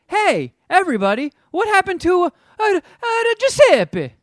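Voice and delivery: loud, high hammed-up Italian accent. Sea monster kid calling his herded fish by name, hammed-up Italian accent. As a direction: shout it out in a cartoon Italian accent, stretching the name. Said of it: "only time he has an Italian accent".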